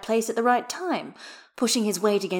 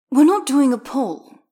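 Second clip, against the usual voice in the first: loud, high refusal; firm announcement; 7 semitones higher, 11 dB louder than usual. A narrator's reading. Skater tells his livestream chat, loud and firm. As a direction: lay down the law, voice raised.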